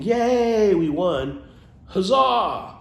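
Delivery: high loud cheering; mock cheer. Shown as a demonstration. Showing viewers how to cheer, mock triumphant shout. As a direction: cheer it out like you just won. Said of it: "Instead of saying".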